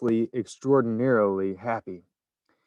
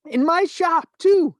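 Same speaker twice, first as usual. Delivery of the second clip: much higher, louder proud yell; 7 dB louder, slower than usual. A narrator's reading. Shopkeeper yelling proudly about his shop, much higher and louder. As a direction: bellow it with delight. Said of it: "he yelled".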